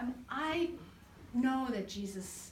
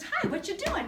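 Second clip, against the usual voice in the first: waitress voice; 11 semitones higher, 6 dB louder than usual. Retold or imitated. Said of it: "and she says".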